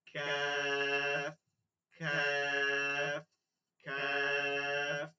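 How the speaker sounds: কাফ stretched very slowly, vowel held long; teacher holds কাফ long for students to copy; long drawn kaf. Shown as a demonstration. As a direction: drag out কাফ like a recitation drill. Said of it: "চারটা হরফ".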